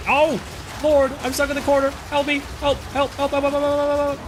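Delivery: high, fast panicked cries; yelling help, cornered and frantic; panicked shout. Real, not acted.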